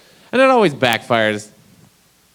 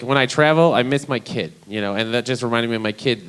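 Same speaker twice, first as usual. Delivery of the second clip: usual speaking voice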